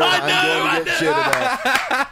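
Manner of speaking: high, laughing through the words. Real, not acted. Laughing about father-in-law hearing.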